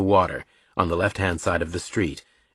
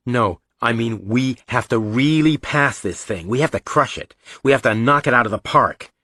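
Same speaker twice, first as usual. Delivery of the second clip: put-on higher, emphatic boy's voice; insistent brother; 3 semitones higher than usual. Brother urging Reacher to crush the test, insistent. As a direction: press each point home urgently.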